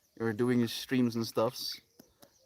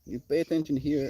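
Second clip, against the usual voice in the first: normal talking voice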